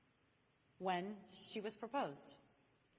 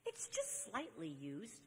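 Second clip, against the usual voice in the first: slow, softer mock wheedle; hammed-up sheepish persuasion, drawn out slowly; coaxing; 2 semitones lower, 7 dB quieter, slower than usual. Young man excusing a used ring, slow coaxing.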